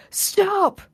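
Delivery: high, urgent mock shout; stretched-out, high-pitched plea to stop; acted alarmed cry. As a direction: imitate a frantic onlooker calling a halt. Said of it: "jumps up ... and go".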